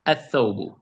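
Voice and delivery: "Athawbu" with no L, straight onto th; merged "Athawbu", L erased; L silent, th doubled. Shown as a demonstration. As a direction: skip the L and hold the th in "Athawbu". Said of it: "you jump from the first letter to the third".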